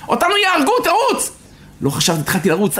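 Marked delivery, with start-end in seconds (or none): 0.1-1.3 high, desperate command; mother shouting. Retold or imitated. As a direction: urge the child to flee, voice way up. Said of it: "צועקת לי". (צעקה נואשת וגבוהה של אמא)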